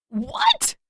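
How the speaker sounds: very high screech of alarm; screechy what. A narrator's reading. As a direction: let the word crack up into a screech. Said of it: "Sokka's voice went screechy".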